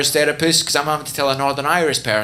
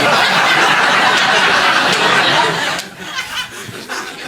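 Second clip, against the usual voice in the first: high unvoiced laugh rising; 3 dB louder than usual. Real, not acted. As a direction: burst out laughing. Laughing mid story.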